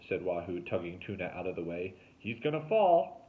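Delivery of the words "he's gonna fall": loud, high warning; alarmed boy, slow. A narrator's reading. Wahoo alarmed.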